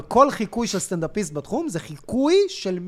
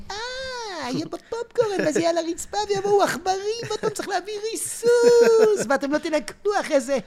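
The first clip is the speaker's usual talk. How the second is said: Asaf Yitzhaki; 12 semitones higher than usual. Retold or imitated. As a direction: fret about mice in a higher voice. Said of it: "אסף יצחקי".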